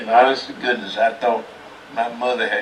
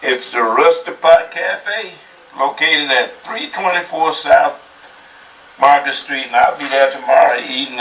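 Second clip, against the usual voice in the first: upbeat higher pitchman read, slowed; 4 semitones higher than usual. Real, not acted. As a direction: sell it like a TV pitchman. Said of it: "slip into his pitchman voice".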